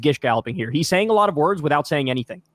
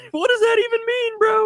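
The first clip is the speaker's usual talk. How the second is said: jumps very high, incredulous; mock confused student. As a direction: play the baffled kid, squeaking. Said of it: "He makes a face like".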